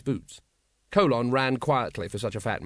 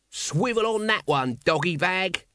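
high, slow jeering taunt; vicious glee; 6 semitones higher, slower than usual. Acted. Nobby jeers at the guard, high and gleeful. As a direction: crow at the guard with nasty delight. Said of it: "in vicious excitement".

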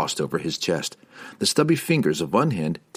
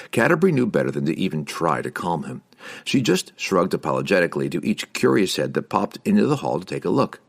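ordinary delivery, nothing marked

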